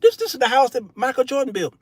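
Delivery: high, confused disbelief; mock bewilderment. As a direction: act baffled at a bird's nest up there. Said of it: "I'm like".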